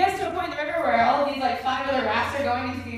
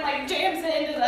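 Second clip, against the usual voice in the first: ordinary talk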